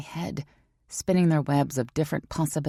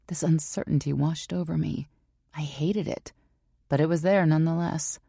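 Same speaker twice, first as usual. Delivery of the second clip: ordinary delivery, nothing marked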